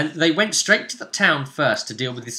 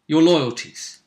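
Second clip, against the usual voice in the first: ordinary delivery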